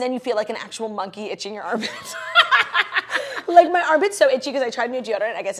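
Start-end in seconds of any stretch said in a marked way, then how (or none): 2.2-3.5 shrieking burst of laughter, much higher; high-pitched laugh. Real, not acted. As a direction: let out a squealing laugh. Itchy armpit laughing fit.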